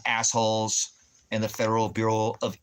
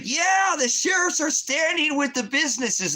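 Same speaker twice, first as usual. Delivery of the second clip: high, loud cheering voice; excited townsfolk; 4 dB louder than usual. Retold or imitated. Locals cheering the fake meme, high and excited.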